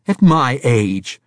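loud, high slow retort; snapping. A narrator's reading. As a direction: snap the words back. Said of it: "Braithwaite snapped".

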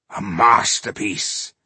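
slow, savoured admiration; extravagant praise. A narrator's reading. Dwarf admiring the underwater iron building, lavishly praising. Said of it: "was extravagant in his praise".

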